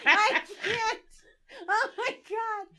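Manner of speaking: high squeal through laughter; too overcome with laughter to speak; helpless laughter. Real, not acted. Helpless laughing fit at the joke.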